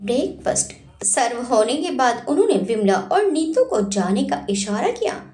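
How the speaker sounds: plain reading voice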